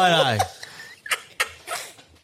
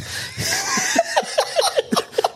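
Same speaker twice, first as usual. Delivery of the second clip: high unvoiced laugh bursting out; shrieking laugh. Real, not acted. Caller cracking up at his own story, shrieking laugh. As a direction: crack up at your own punchline.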